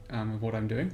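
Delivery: normal talking voice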